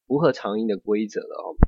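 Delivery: normal talking voice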